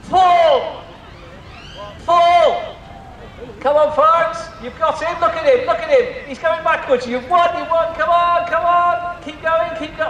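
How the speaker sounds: high excited cheering, calling pull; tug of war. Real, not acted. Leading crowd tug of war.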